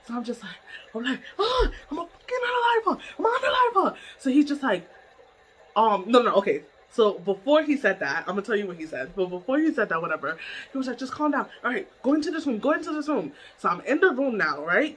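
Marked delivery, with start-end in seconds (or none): 1.4-4.0 high, strained wail of rage; retold screamed threat. Retelling meltdown to boss, high raging wail. 11.0-13.3 retold calming instruction. Boss sending her into room, higher urgent calming.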